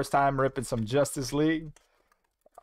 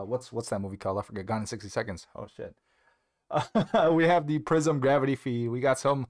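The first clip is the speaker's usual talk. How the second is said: usual speaking voice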